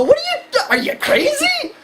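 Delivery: very high-pitched disbelief; mock-exclaim in utter disbelief; incredulous reaction. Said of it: "sit there and laugh and go".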